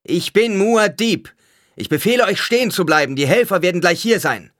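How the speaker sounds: high, full-voiced commanding shout. A narrator's reading. Paul asserts his rule over the panicking blind, shouted command. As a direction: proclaim it with authority over the clamour. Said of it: "rief er".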